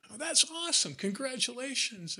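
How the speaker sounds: lightly imitated, high and bright congratulating; mild mock excitement at good news; excited congratulations. Retold reply to news.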